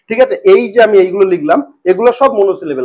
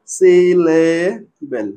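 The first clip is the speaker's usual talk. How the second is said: "Syllable" very slow, each syllable held; broken into syllables; 3 semitones lower, much slower than usual. Shown as a demonstration. Teacher spelling out monosyllable for students, "syllable" slowly broken up. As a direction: pull "syllable" apart slowly. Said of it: "ছোট ছোট টুকরো করে ভাঙবো".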